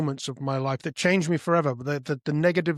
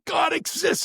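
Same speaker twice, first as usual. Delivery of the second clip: high-pitched excited cry; excited announcement; 13 semitones higher than usual. Retold or imitated. Son telling mother his revelation, high excited cry. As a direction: announce it high, as huge news.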